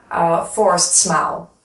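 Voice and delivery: ordinary delivery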